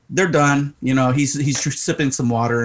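ordinary talk

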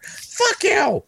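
high, sharp mock snap; angry retort. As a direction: fire it back, offended. Shakes snaps back at teasing.

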